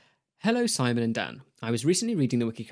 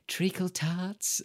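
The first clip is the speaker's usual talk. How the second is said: put-on child catcher; 7 semitones higher, 3 dB quieter, much slower than usual. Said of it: "the child catcher goes".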